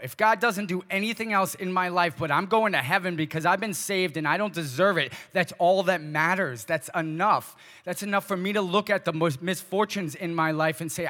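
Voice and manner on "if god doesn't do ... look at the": fervent preaching. Acted. Preaching climax on salvation.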